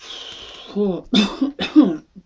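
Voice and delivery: big winded exhale, then coughing; exhausted puff and throat-clearing coughs; out-of-breath puff. Pretend. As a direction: blow out hard and cough as if winded. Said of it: "I'm out of breath".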